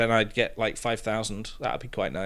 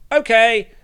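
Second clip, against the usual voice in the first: sudden high, loud snapped okay; a loud, high, fed-up okay; curt okay; 12 semitones higher, 10 dB louder than usual. Retold or imitated. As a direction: bite off a sharp, loud okay. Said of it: "And I was like".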